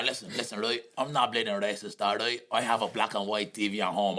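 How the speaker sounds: low, earnest mimicked Dubliner; Dublin man. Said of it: "I will mimic them just for the crack".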